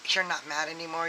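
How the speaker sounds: ordinary delivery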